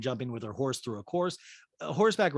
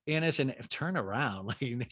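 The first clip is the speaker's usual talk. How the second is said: ordinary talk, nothing marked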